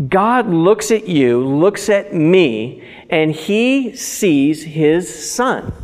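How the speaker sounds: deliberate, measured pulpit proclamation; emphatic preaching. Real, not acted. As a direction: drive each phrase home slowly, pausing between them. Preaches a key point with weight.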